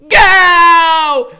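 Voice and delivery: high, loud yell to run; shouting at the beast to go. Acted. Freak screaming at his friend to run, loud high yell. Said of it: "Freak is yelling".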